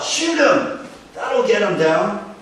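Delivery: high, loud heckling shout; yell from crowd. Retold or imitated. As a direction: bellow from the back of the crowd. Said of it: "yelled out".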